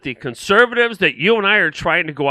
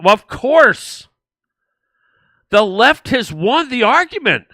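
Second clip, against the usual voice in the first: slow, high sneering sarcasm, drawn out; mock their smug claim, slow and high; sarcastic mock; 8 semitones higher, 3 dB louder, slower than usual. Host sneering at the board member's claim, slow and mocking.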